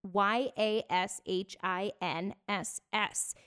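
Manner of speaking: slow letter-by-letter spelling; spelled out. Shown as a demonstration. Host giving listeners an Instagram name, spelled out slowly. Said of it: "I'll spell it out for you".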